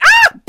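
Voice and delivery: short, very high loud shriek; sudden piercing startled scream; girlish scream. Retold or imitated. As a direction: shriek in fright like a girl. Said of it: "I screamed like a girl".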